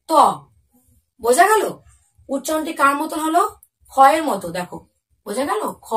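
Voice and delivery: ordinary talk, nothing marked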